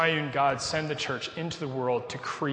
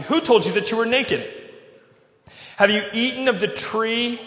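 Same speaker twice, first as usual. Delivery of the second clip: higher, raised voice of God questioning; God confronting; 6 semitones higher, 4 dB louder than usual. Acted. God questions Adam about the tree, raised confronting voice. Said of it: "This is God speaking".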